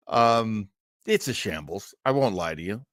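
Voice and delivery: normal talking voice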